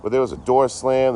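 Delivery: ordinary talk, nothing marked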